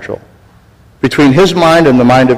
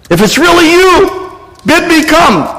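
pleading Peter; 13 semitones higher than usual. Retold or imitated. Peter voiced in story.

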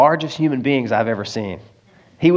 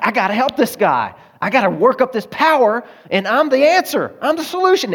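rising higher, puffed-up hero boast; mock self-important; 7 semitones higher than usual. Preacher mocking his past savior complex, puffed-up and rising. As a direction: ham up your own pride.

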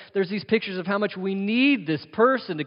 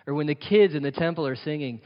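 usual speaking voice

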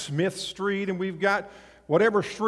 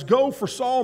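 higher, rising go, quicker; brisk higher reread; 5 semitones higher than usual. Shown as a demonstration. Preacher rereading the Lord's words to Ananias, higher and brighter. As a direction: read the command again with lift. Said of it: "And he tells Ananias".

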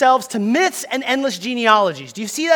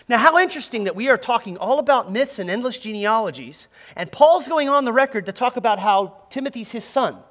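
ordinary talk, nothing marked

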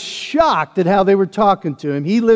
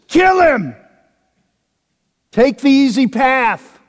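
high, loud cry of an urging mob; mob shout; 9 semitones higher, 6 dB louder than usual. Retold or imitated. The mob behind David urging him to strike Saul, loud shouting. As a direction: shout it like a crowd egging him on.

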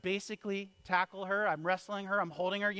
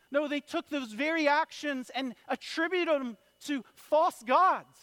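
building, high-pitched sermon climax; fervent pulpit delivery, pitched up; impassioned preaching; 8 semitones higher, 6 dB louder than usual. Acted. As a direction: preach with rising force, louder toward the end.

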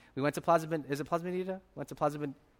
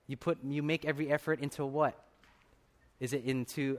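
normal talking voice

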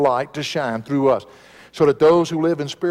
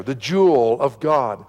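normal talking voice

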